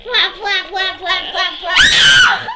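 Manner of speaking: flapping chant rising to a shout; flap, flap, building to a squeal; wing flapping. Pretend. Wings mentioned, kid flaps aloud.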